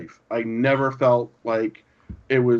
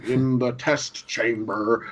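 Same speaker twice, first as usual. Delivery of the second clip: slow, drawn-out mock-stern dad voice; deep voice; 3 semitones lower, 3 dB quieter, slower than usual. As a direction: imitate a stern father, dragging each word slowly. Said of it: "he says it with this deep voice".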